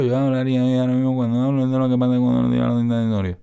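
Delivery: consonants smoothed away with no punch; no attacks. Shown as a demonstration. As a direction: speak mushy, as if every attack were cut off. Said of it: "si yo no tuviera transitorio".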